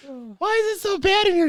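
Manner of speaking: put-on high squeaky child asking; little boy wrinkling his nose; high loud child question; high kid voice. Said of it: "the kid's just like".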